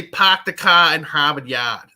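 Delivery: slow Boston accent with dropped r's. Shown as a demonstration. Host showing co-host how to do it, demonstrated Boston accent. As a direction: drop the r's in "Park the car in Harvard Yard", Boston style. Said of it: "you just gotta say".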